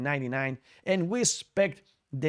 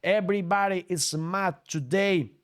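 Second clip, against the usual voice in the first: usual speaking voice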